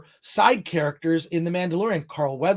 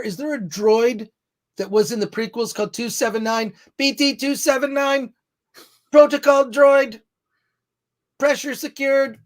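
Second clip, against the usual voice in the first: put-on old speech synthesizer voice, higher pitched; robot voice; 6 semitones higher, 4 dB louder than usual. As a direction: talk like a clunky protocol droid. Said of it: "That's my robot voice".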